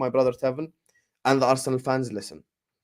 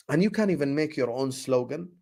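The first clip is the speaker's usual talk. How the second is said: usual speaking voice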